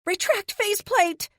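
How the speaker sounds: high shouted command over the wind; yell. A narrator's reading. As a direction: yell the order at the armor. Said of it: "I yell".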